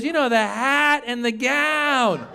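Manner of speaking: high, slow, stretched-out club-owner impression; imitated older woman drawing out every word; Mitzi. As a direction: drawl it insistently, falling at the end. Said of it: "That's how she would talk".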